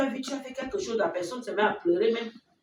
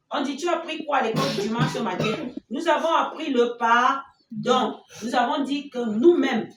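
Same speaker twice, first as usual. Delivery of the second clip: récapitulation forte; 4 semitones higher, 8 dB louder than usual. Real, not acted. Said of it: "récapitule la leçon fort".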